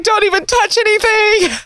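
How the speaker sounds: high, prissy don't-touch-it voice; squeamish. Retold or imitated. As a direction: beg nobody to touch a thing. Mock disgust at studio.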